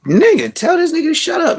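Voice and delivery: very high squeaky mock voice of his annoyed girlfriend. Retelling Ebony's silent look at him, very high mock voice. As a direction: squeak it out like the irritated girlfriend in bed.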